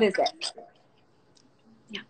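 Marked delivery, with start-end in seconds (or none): 1.9-2.0 soft whisper. Real, not acted. Mom whispering to her child off mic.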